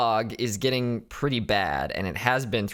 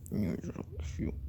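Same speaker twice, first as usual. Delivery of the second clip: soft whisper to the cat; barely voiced, tender to the cat; hushed cooing whisper; 9 dB quieter than usual. Real, not acted.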